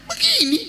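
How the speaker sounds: sharp high "Begini" like a karate chop; mock strike cry. Shown as a demonstration. Preacher acting the teacher's karate strike, sharp high cry. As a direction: snap it out like a blow. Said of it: "bukan begini dia mukul".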